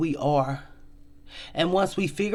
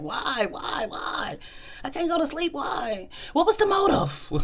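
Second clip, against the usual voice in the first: mock sleepless wondering; 10 semitones higher, 6 dB quieter than usual.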